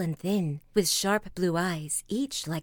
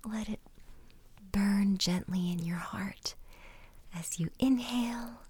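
hushed ASMR meditation voice; whispered meditation; 7 dB quieter than usual. Acted. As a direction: whisper them gently into calm.

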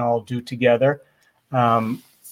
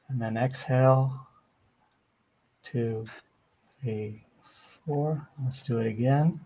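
soft spa whisper; 5 dB quieter, much slower than usual. Acted. Counts breaths for listeners.

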